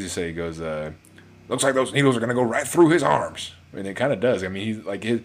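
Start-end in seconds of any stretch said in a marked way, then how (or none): 1.5-3.5 loud, raised general's voice; higher, louder, put-on character voice; Tommy Lee Jones. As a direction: imitate a movie general calling out a remark. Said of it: "Tommy Lee Jones, he goes".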